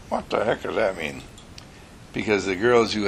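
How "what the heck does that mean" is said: puzzled dog. Acted. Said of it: "And Rover said".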